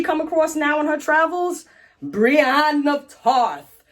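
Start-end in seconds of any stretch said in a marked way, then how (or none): 2.0-3.8 drawn-out, raised dramatic name reveal; grand announcement. Real, not acted. Revealing who she meets on her travels, grand announcement. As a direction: announce it very slowly like a big entrance.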